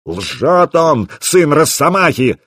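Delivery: acted outraged shout, held in volume; angry yell. A narrator's reading. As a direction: hurl it as a furious accusation. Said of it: "заорал Расмус".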